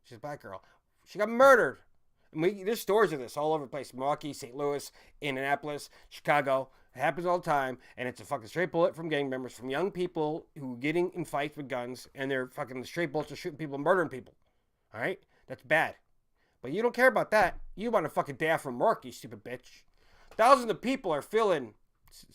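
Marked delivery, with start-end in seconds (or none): 1.1-1.8 loud, higher exclamation; outraged shout; angry outburst. Real, not acted. 16.6-21.7 furious insulting rant; angry rant. Real, not acted.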